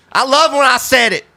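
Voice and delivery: very high-pitched comic exclamation; high put-on voice. Host tossing out a catchphrase, very high comic voice. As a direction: shoot way up in pitch.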